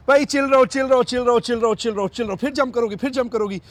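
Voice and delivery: high, loud, rapid-fire repetition; urgent calming of dog. Real, not acted. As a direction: talk down an overexcited dog, loudly. Restraining a jumpy, excited dog.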